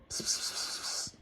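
soft unvoiced pss-pss to lure the cat; whispered cat call. Real, not acted. Owner luring the cat with whispered pss pss.

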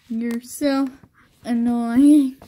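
loud, exasperated cry; character yelling. Acted. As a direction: fling the insult out in a shout. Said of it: "is what the character said".

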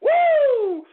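high, loud whoop of glee. Real, not acted. Host cheering at good political news, big high whoop. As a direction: let out a big joyful whoop.

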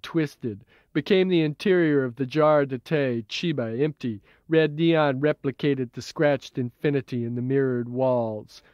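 usual speaking voice

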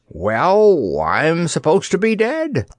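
huffy reproach; dummy reproaching the girl who saved him; reproachful puff. A narrator's reading. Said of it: "puffed the dummy reproachfully".

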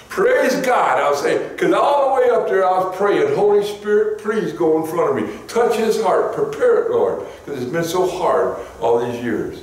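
high, fervent prayer cry; fervent praise. Acted. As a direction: lift it up to the Lord. Preacher praying fervently.

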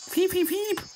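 very high squeaky beeping; alarm beeps. Pretend. Statue alarm sound.

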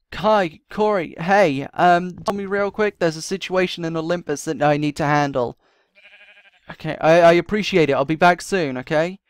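loud, hurried call to friends across the yard. Acted. Calling to Kai and Corey before rushing off, loud. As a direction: raise the voice so they hear from afar.

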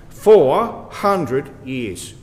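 "four hundred years" very slowly, word by word; emphatic pauses. Real, not acted. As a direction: set "four", "hundred", "years" apart for weight. Contrasts it with five, ten, twenty.

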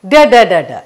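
d, d, d repeated crisply and loud; phonics d sound. Shown as a demonstration. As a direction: tap out each d sound for the class. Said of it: "And rod spelling r".

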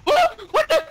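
very high, loud shriek; startled shriek. Real, not acted. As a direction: shriek as if suddenly hit. Startled by sudden hit.

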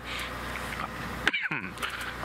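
long harsh cough; coughing fit. Real, not acted. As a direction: cough it out hard. Just swallowed a bug.

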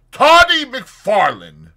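slow, high, very loud name-call; insistent loud hail. A narrator's reading. Fetz repeats the familiar name, calling out loudly. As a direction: hail him forcefully, stretching the name. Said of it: "repeated Fetz".

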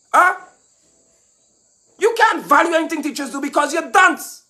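angry shout. Real, not acted. A scolding rant at the education minister, high and shouted.

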